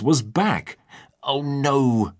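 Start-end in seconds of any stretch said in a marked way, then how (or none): 1.2-2.1 alarmed cry. A narrator's reading. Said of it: "cried Tiger".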